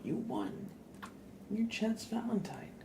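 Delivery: ordinary talk, nothing marked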